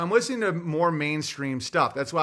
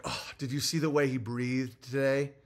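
softer, hushed gossip of petty trolls; mocking gossiping trolls; 7 semitones lower, 7 dB quieter than usual. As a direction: sneer like a nosy busybody. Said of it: "They're like".

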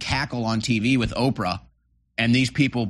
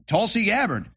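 usual speaking voice

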